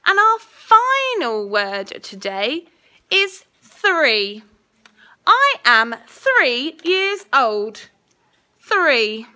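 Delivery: slow, clear dictation voice; school dictation. Acted. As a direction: say each word carefully for spelling. Teacher dictating a spelling test.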